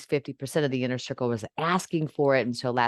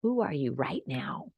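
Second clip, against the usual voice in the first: whispered question, no voice; 6 dB quieter than usual. Shown as a demonstration. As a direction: breathe the question softly. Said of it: "repeated, then whispered".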